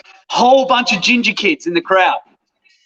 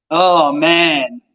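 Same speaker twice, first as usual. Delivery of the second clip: normal talking voice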